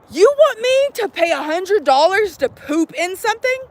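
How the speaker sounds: high-pitched, indignant disbelief; incredulous. Real, not acted. Vlogger balking at a hundred-dollar poop tent, incredulous. Balks at the price of tents.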